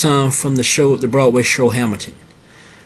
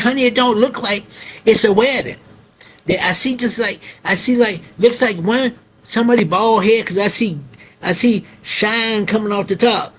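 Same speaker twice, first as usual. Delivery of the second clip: wife's voice; 7 semitones higher than usual. Retold or imitated. Wife voiced in retold story.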